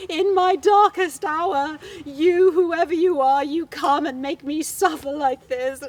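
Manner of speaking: high, loud, slow melodramatic lament; hammed-up grief, high and loud; wailing widow. Upset wife accusing the stranger, loud high wailing. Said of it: "she got even more upset".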